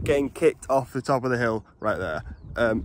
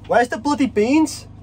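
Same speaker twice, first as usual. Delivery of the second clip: excited; 9 semitones higher, slower than usual. Real, not acted. Excited about food.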